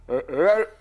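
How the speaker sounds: usual speaking voice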